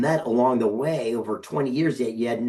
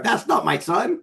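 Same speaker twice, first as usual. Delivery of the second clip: high, comic pretend-stern dad; mock dad quote; 12 semitones higher than usual. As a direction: play a joking father disowning his son, pitched way up. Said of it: "he pops on and goes".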